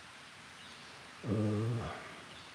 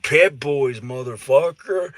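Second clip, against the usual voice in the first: retold shout; 4 semitones higher, 9 dB louder than usual. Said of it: "he was like".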